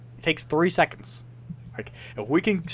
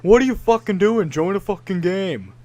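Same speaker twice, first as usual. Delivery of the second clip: higher, snapping mock viewer; impatient viewer; 5 semitones higher than usual. Imitating viewers demanding he join a game. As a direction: sneer it like an impatient chatter.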